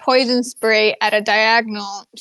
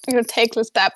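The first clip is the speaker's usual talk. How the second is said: ordinary talk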